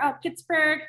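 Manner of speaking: ordinary talk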